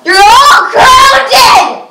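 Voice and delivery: loud, slow, high scolding shout; mock stern mom. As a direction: play the strict mother grounding kids. Mock parent scolding siblings.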